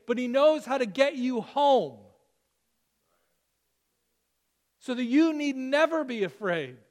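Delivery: preaching with conviction; full-force preaching. Acted. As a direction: build it up and promise them.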